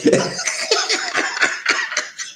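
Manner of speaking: very high airy laugh; high breathy laugh. Real, not acted. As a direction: crack up breathlessly. Big laugh.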